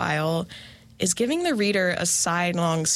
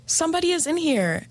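high, quick frightened exclamation; acted alarm; 10 semitones higher, faster than usual. A narrator's reading. Ellen tells her father about the intruder, acted alarm. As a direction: blurt it out high, as a mild story-time fright.

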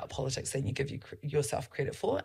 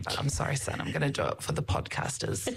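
ordinary talk

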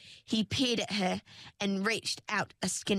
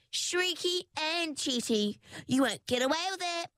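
jeering taunt; 8 semitones higher than usual. Acted. Fish jeering at queue jumper.

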